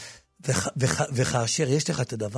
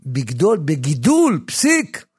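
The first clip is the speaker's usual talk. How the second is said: loud, high, slow proclamation; preaching at full force; 10 semitones higher, 10 dB louder, slower than usual. Acted. Preacher declaring the verse heals the growth, loud and slow. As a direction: declaim it like a blessing over the sick.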